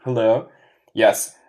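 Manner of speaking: ordinary talk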